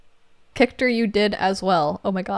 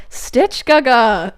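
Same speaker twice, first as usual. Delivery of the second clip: gleeful nickname; 3 semitones higher, 6 dB louder, much slower than usual. Retold or imitated. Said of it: "Kictor calls Stitch Gaga".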